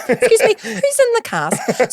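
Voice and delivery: high, slow, genteel query; prim theatregoer. Retold or imitated. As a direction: naive tourist asking about the cast, prim and high. Posh naive theatregoer asking.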